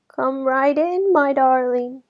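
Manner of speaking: high, slow granny voice; sweet old granny calling out; grandmother voice. A narrator's reading. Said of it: "replied the grandmother".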